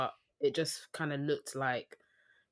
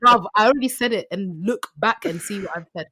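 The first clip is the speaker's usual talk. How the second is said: blunt annoyed; 3 semitones higher, 10 dB louder than usual. Retold or imitated. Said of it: "instead of saying".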